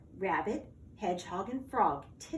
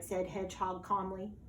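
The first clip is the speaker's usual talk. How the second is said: ordinary delivery, nothing marked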